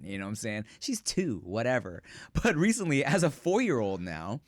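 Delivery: normal talking voice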